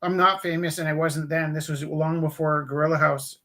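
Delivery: ordinary talk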